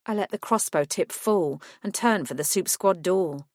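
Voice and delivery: ordinary delivery, nothing marked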